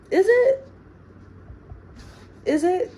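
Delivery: high, loud "Is it?" in disbelief; incredulous. Real, not acted. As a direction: ask it twice, high and loud, doubting. Doubting her own claim.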